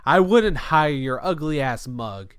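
loud, slow, drawn-out mock insult; imitated contemptuous insult. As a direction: hurl each word like the irate customer. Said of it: "literally said it just like that".

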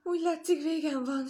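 high, loud storybook lament; dramatic sigh of despair. A narrator's reading. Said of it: "sóhajtotta".

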